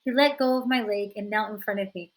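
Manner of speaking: usual speaking voice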